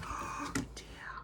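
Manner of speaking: hushed anxious whisper; worried whisper. Acted. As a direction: breathe it out in worry. After the sneeze.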